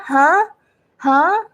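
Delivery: "Huh?" swept upward like a question; second tone, rising. Shown as a demonstration. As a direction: lift "Huh" high at the end. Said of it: "the second tone going up".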